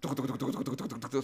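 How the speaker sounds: rapid soft "tuc" knocking noises; imitated window rattle. Imitating his windows during a tremor, rapid rattling. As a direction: mimic a rattling pane, low and quiet. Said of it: "suena así, le digo".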